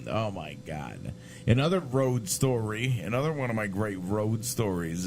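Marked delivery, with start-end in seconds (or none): 0.1-1.1 muttered aside. Retold or imitated. Stunned reaction retold.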